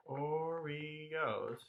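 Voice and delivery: soft chant of "Oreos" in three beats; "Oreos" softly in cherry pie rhythm; matching rhythm. Shown as a demonstration. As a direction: draw out "Oreos" as O-re-os. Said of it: "would match up with".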